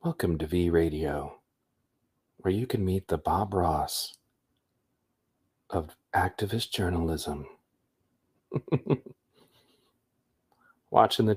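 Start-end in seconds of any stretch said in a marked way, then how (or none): 0.0-7.4 smooth radio host. Pretend. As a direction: announce like a late-night DJ. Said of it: "My proper radio voice".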